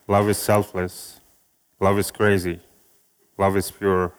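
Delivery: ordinary talk, nothing marked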